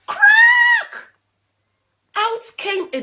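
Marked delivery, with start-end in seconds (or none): 0.1-1.1 high loud shout of crack!; big crack. Acted. The last egg hatching for a child, shouted crack. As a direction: burst it out like the shell breaking.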